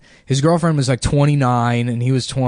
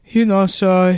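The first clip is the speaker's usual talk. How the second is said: put-on higher, hesitant Mexican-accented voice; 7 semitones higher, 4 dB louder, slower than usual. Landlord stopping the departing renter, hesitant Mexican accent. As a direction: imitate a Mexican landlord tentatively starting up. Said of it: "this Mexican dude's like".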